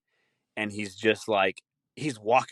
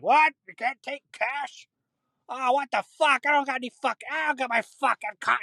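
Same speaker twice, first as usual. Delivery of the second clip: put-on high incredulous old-timer whine; outraged old person. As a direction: act like a flustered old man at the till.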